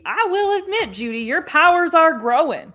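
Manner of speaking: high mock-dramatic quote; Jesse impression. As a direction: imitate Jesse conceding, voice pushed up high. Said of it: "when Jesse says to Judy".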